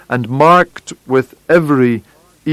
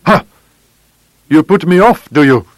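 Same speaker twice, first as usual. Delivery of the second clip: higher, sharp indignation; angry challenge; 3 semitones higher, 4 dB louder than usual. A narrator's reading. Visitor being put off by Holmes, indignant and sharp. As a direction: throw it back as an affronted challenge.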